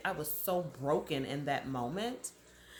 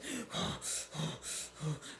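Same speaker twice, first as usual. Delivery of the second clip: a whispered, heaving gasp to show the panic; heavy gasping breath; 6 dB quieter than usual. Shown as a demonstration. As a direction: breathe in hard and ragged. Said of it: "I'm doing some of this".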